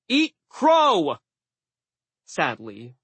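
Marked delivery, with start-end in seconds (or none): 0.1-1.2 high, loud battle call; urgent high shout; shouted signal. Acted. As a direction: yell it out to distant allies. Hero calls signal to crows.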